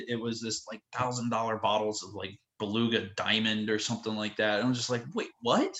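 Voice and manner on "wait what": high startled double take; disbelief. Retold or imitated. Guest shocked at the vodka price, high disbelief. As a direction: react like you can't believe the price.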